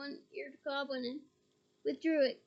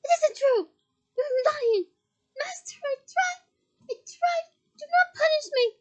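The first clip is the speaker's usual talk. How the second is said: much higher, loud desperate crying plea; terrified pleading; 13 semitones higher, 11 dB louder than usual. Acted. Bellatrix begging her master in terror. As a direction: wail it, begging not to be punished.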